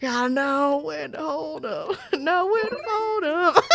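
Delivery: quoted line squeaked out high through laughter; laughing imitation. Retelling Queso at the casino, high and laughing. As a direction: try to quote him but keep cracking up. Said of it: "he's like".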